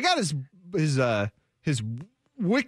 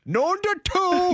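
lightly voiced John Gruden impression; 11 semitones higher, slower than usual. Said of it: "trying to do a John Gruden".

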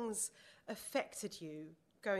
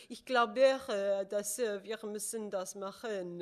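German spoken in a French accent, slow, louder at the start; 5 dB louder, slower than usual. Shown as a demonstration. Host showing off her German in a French accent. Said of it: "but with a French accent".

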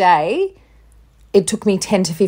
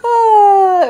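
melting aww; 13 semitones higher, 6 dB louder than usual. Real, not acted. Melting at son's cuteness.

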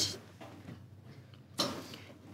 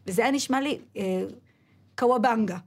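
ordinary talk, nothing marked

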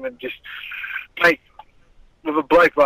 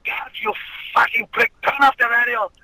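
mock Russian accent, higher and heated; imitating big Russian voice; 9 semitones higher than usual. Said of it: "big Russian voice going".

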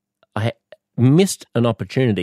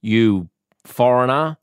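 mock xenophobic; 3 semitones higher, slower than usual. What he wouldn't say to a foreign driver, mock hostile. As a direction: spell it out like a hammed-up bigot. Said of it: "I wouldn't say".

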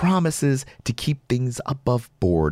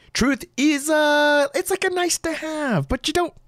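sarcastic high voice. Real, not acted.

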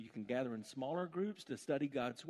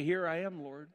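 fervent preaching; 7 semitones higher, 9 dB louder than usual. Real, not acted. Quoting the hymn loudly.

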